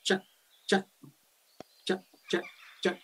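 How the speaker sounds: short sharp chat calls; jackdaw call. Shown as a demonstration. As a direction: clip each chat like a jackdaw. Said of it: "a quick call, just to remind you".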